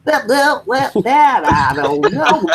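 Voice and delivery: high, slow hillbilly drawl; backwoods folk voice. Retold or imitated. Man imitating backwoods people for his friends, slow high hillbilly drawl. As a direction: stretch it out like a backwoods local. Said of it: "the people that come, you know, from the backwoods are".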